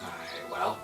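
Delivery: usual speaking voice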